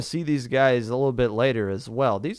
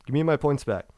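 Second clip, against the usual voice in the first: usual speaking voice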